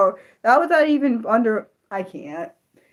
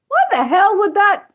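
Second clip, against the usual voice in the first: high, loud startled what the hell; shocked outburst; 12 semitones higher, 6 dB louder than usual. Real, not acted. Reacting to chat explaining power slap, shocked outburst. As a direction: blurt it out in disbelief.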